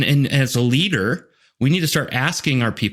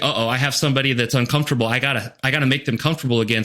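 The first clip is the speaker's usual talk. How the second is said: mock panic; much faster than usual. Mocking a panicking leader.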